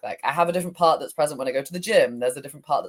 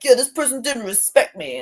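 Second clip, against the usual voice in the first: higher, wounded voice of a slighted part; the angry part answering her, higher and hurt; hurt inner part; 9 semitones higher, 3 dB louder than usual. Acted. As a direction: speak as the part that feels disrespected. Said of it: "I got really into character".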